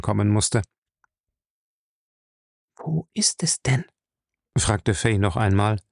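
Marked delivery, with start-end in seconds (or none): 2.8-3.8 breathy anxious whisper. Acted. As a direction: whisper the question, scared. Frightened wife whispering at night.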